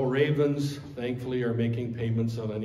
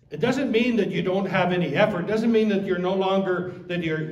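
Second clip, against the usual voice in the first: normal talking voice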